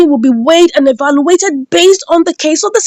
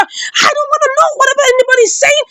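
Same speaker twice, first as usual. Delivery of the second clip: high, insistent mock yell; screaming and shouting; 11 semitones higher than usual. Jeff demanding his sister back, screaming and shouting. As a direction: act out a high-pitched tantrum. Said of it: "screaming and shouting".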